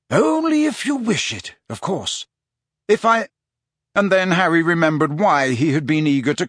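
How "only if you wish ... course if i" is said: lightly voiced old wizard, gentle and unhurried; an old headmaster kindly offering a choice; Dumbledore.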